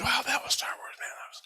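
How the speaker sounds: hushed, breathless wonder; unvoiced whisper of wonder; awed whisper. Real, not acted. Marvelling at his vision.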